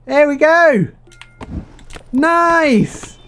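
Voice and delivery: high excited cheering; thrilled at the new land; delighted high cheer. Real, not acted. Gamer thrilled at reaching a new land, high and excited.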